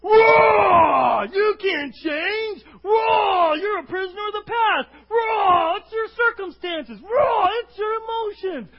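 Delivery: roaring giant's taunts, high and very loud; shouted jeers from a menacing giant; Goliath. Acted. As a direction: bellow to intimidate, roar between each taunt. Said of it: "Because Goliath is out there".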